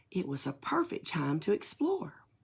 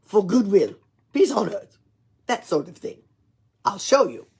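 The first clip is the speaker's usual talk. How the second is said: put-on louder, jolly Russian-accented Nutcracker; 3 semitones higher, 9 dB louder than usual. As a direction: show him proudly in a Russian accent. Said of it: "he spoke in a Russian accent".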